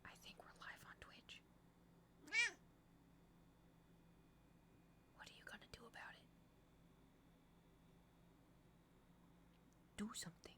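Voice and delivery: hushed whisper to chat. Real, not acted. Whispering a tease to the stream chat. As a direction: breathe it, no voice at all.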